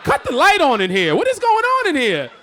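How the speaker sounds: high, loud scolding shout; loud mock-outraged parent; furious dad yell.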